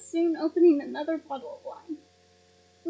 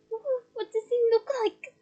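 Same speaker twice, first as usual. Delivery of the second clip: Fleur's voice; 7 semitones higher than usual. Acted. Fleur asks, higher voice.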